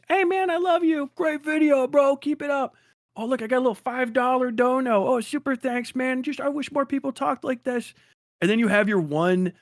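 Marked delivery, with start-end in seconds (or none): 0.1-7.9 much higher, gushing fan in falsetto. Retold or imitated. A fan leaving gushing comments, high falsetto praise. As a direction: play a sweet adoring commenter, pitched way up. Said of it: "my red dots are here".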